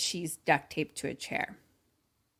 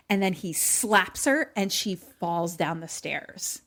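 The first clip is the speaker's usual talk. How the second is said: ordinary talk, nothing marked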